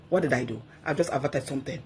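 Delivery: usual speaking voice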